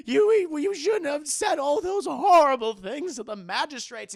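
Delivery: high, loud, slow wailing blame; wailing lament. Retold or imitated. Mother wailing blame at her husband in bed. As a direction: wail it, half crying.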